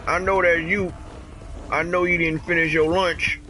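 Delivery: high teasing voice calling to the pet; mock taunting. Owner teasing the pet in a high taunting voice.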